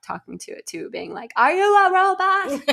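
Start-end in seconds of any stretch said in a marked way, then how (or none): 1.4-2.7 put-on high, loud kid yell. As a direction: shout it like an excited kid. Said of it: "one of the kids was yelling that".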